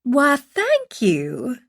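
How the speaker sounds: slow, drawn-out Southern thank-you; Southern accent. A narrator's reading. Young mother thanks Gina for the compliment, Southern accent. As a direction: let the Southern accent stretch the vowels. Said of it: "in a strong Southern accent".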